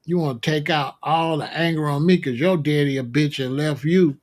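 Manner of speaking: ordinary talk, nothing marked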